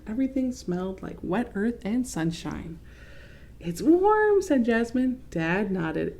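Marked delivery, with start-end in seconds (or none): 3.6-4.4 little sister voice. Acted. Little sister's line in story.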